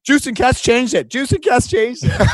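high, whiny tattletale voice; whiny blaming voice. Retold or imitated. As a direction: whine it, passing the blame. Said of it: "Trent Yawney's like".

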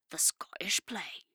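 slow, hushed bad Scottish accent. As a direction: whisper it in a hammy Scottish accent. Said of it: "Sorry for my terrible Scottish accent".

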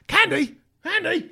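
high loud call up the stairs; wife calling out. Retold or imitated. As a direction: call the dog's name from upstairs. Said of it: "I hear my wife upstairs".

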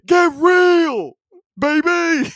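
put-on high, loud shouted get real, baby; Dick Vitale catchphrase. Podcaster punches the catchphrase in his announcer impression. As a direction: bellow the catchphrase like a courtside announcer. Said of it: "This is my impression of Dick Vitale".

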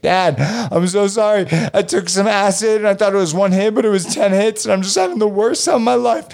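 put-on high, frantic teenage rush of words; panicked confession. Tripping teen confessing the acid to his dad, high and frantic. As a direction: blurt it all out to dad in a panic.

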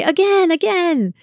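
put-on high, drawn-out child's plea; gleeful kids. Kids on the swing begging mom, imitated gleeful voice. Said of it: "They'd laugh and say".

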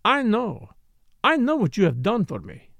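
a hint of French, pouting at him; lightly French-accented Morel. Morel's voice held.

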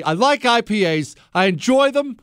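mock confession. Real, not acted. Host confessing on air, mock-dramatic. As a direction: proclaim it like a big reveal. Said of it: "I just admitted it to everybody".

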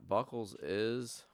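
ordinary delivery

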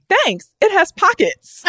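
high, slow, gushing thanks. Acted. Woman answers a compliment on her outfit, high and gushing. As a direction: gush it in a put-on high voice. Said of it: "their sole response was".

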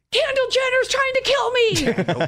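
high, slow panicked scream. Retold or imitated. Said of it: "I started screaming in a panic".

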